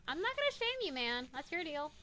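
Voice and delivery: high, loud, defensive reassurance; high-pitched protest. Real, not acted. Investigator answering a spirit box's confession, high and defensive. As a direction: pitch it way up, backing off. Awkward reply to spirit box message.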